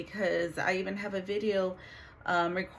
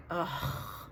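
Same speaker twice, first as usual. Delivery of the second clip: dread; 9 semitones higher, 3 dB louder, slower than usual. Retold or imitated. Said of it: "and I'm like".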